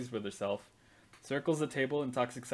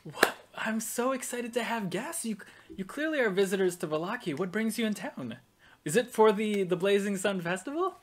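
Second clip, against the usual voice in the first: higher, eager baroness voice; excited hostess; 7 semitones higher than usual. Acted. Baroness thrilled by her visitors, higher and excited. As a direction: gush over the guests. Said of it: "and she goes".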